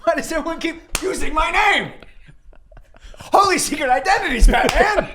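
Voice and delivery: high, exclaiming sidekick voice; high, hammed-up superhero sidekick; exasperated Robin. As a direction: cry out in high-pitched comic indignation.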